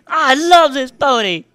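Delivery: high, loud gush of love; gushing shout. Acted. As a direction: burst out like a fangirl. Gushing declaration.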